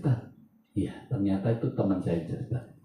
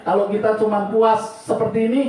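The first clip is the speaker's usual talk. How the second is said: high, loud pulpit proclamation; impassioned preaching; 13 semitones higher, 7 dB louder than usual. Real, not acted. Preacher vows to serve God wholeheartedly, preaching with full force. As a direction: declare it forcefully to the congregation.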